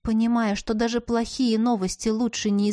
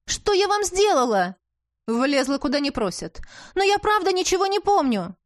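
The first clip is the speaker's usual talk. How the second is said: pleading heroine; 6 semitones higher than usual. A narrator's reading.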